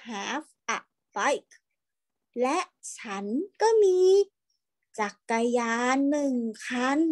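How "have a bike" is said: very slow, word by word; slow model reading. Shown as a demonstration. Teacher reading to online class, slow and clear. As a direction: read "I have a kite" slowly for children to repeat. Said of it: "ครูให้อ่านตามนะ".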